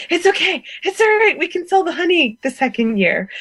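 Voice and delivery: mock reassuring. Soothing her husband about cost.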